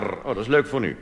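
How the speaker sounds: normal talking voice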